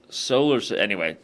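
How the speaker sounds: normal talking voice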